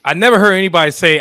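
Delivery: loud, fast, incredulous; excited. Real, not acted.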